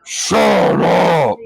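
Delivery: a hill giant bellowing down at squabbling goblins; giant's shout. Acted. Giant inside the gate yelling at goblins, deep and booming. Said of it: "You hear in giant".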